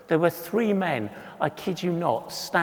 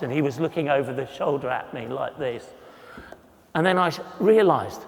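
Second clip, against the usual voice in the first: ordinary talk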